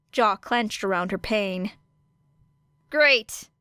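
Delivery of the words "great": higher, louder snarl through gritted teeth. A narrator's reading. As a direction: bite the word out in pain. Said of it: "she snarled between gritted teeth".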